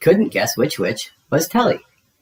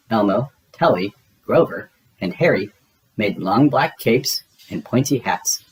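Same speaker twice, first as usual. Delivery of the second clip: ordinary delivery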